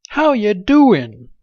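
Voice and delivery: high, slow, loud hammed-up Southern greeting; mock Southern accent. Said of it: "speaking in an accent like that".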